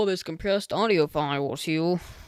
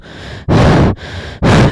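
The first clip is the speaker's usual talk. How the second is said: loud sigh right into the mic; breath into mic; 12 dB louder than usual. Real, not acted. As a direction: exhale straight into the microphone. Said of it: "you can hear me breathe like that".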